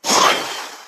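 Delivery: long breathy exhale imitating the heart filling; divine sigh. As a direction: breathe out slowly like a balloon swelling. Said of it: "and Allah went".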